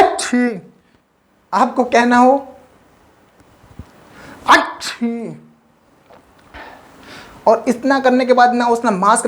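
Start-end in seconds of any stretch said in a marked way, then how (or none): 0.0-0.6 imitated cough sound; mock cough. Teacher acting a man coughing nearby, mock cough. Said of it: "खांसना शुरू कर". 4.5-5.4 imitated cough sound; mock cough. Teacher acting a man coughing nearby, mock cough. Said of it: "खांसना शुरू कर".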